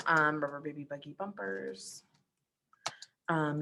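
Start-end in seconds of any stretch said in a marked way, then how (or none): 0.4-2.0 softer, under-the-voice tongue twister; tongue twister practice. Shown as a demonstration. Teacher showing students a vocal warm-up, quiet tongue twister.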